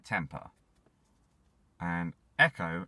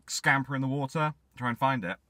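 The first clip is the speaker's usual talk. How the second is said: plain reading voice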